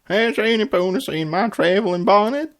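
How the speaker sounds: reading a cartoon character's line; acted cartoon line. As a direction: read it as a bright character. Reading episode quotes aloud.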